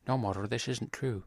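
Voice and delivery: soft, lightly voiced Scully; a hint of Scully, as if chewing gum; Scully impression. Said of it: "have you been working in your Scully?".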